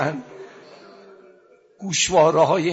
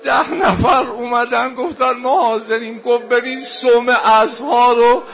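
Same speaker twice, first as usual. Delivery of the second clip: high, loud lament, breaking into sobs; keening elegy with a sobbing voice; wailing mourning sermon; 8 semitones higher, 7 dB louder than usual. Real, not acted. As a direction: preach the tragedy at full force, weeping between lines. Mourning sermon at full force.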